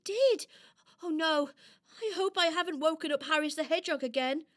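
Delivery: high, worried doll voice; startled little doll. Acted. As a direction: puzzle anxiously over a strange noise. Doll hears a strange sound.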